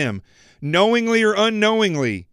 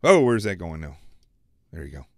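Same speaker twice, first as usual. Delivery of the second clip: usual speaking voice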